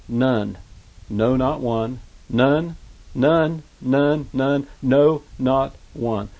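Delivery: deliberate, punched "none" and "no, not one"; emphatic repetition. Shown as a demonstration. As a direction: stress each "none", set apart by pauses. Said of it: "note the emphasis".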